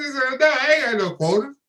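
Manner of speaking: mock hammered-home reply; emphatic retort.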